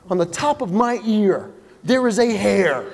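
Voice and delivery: normal talking voice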